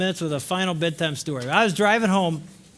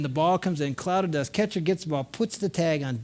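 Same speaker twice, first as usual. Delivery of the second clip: normal talking voice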